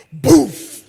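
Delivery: imitated plate smash, loud and sudden; loud crash sound. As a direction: smack the sound out like a plate hitting the wall. Prato jogado na parede. (som de prato batendo na parede, alto)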